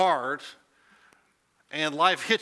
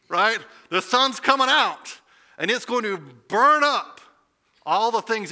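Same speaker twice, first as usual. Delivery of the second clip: full-force preaching; 7 semitones higher, 8 dB louder than usual. Acted.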